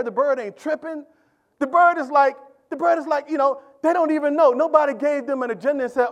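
ordinary delivery

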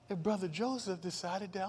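normal talking voice

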